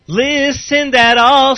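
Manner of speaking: sarcastic drawl. Real, not acted. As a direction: drag it out mockingly. Sarcastic dig at [name].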